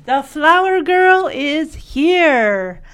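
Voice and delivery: slow, drawn-out grand announcement; grand self-introduction. Retold or imitated. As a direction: present yourself, stretching the words. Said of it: "presents herself".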